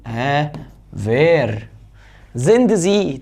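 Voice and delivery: ordinary talk, nothing marked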